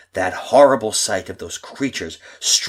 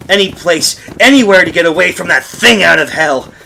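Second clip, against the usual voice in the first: panicked; 5 semitones higher, 8 dB louder than usual. A narrator's reading. Panicked flight narration.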